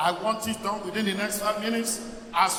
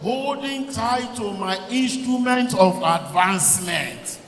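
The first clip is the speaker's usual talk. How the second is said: slow decree building to a shout; prayer decree; 3 dB louder, slower than usual. Shown as a demonstration. Preacher models a decree for the congregation, building to a shout. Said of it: "then you make a decree".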